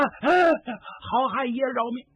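high, panicked plea for mercy; a startled cry, then a squealed plea; begging for mercy. Acted. Old woman begs Yan Qing for her life, high panicked plea.

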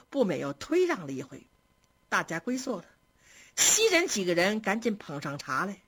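ordinary delivery, nothing marked

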